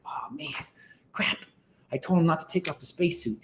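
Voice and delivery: under-the-breath whisper of regret; hushed, fast aside; whispered dismay. Retold or imitated. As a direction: mutter it to yourself, dismayed. Neil dismayed, muttering to himself.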